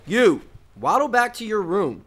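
loud, high holler down the hall; loud, bossy command; shouted order. Acted. Ordering someone off, across a distance.

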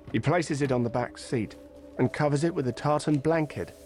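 ordinary talk

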